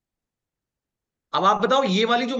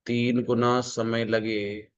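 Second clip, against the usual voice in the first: lower, slow dictation, phrase by phrase; 8 semitones lower, much slower than usual. Acted. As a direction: dictate each phrase slowly so students can write.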